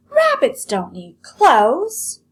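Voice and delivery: loud, high, slow scorn; shouting. Acted. Lolly shouting scornfully at Nellie about the rabbit, loud, high and slow. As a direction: bellow it with contempt. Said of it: "shouted Lolly".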